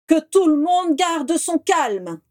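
calm a crowd with authority; Dumbledore's powerful voice. Acted. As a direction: headmaster steadying the panicked crowd, slow and commanding. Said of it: "dit la voix puissante de Dumbledore".

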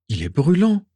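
alarmed at the heat of his skin; anxious. A narrator's reading. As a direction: Regulus touching the elf's burning forehead, anxious. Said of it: "constata anxieusement Régulus".

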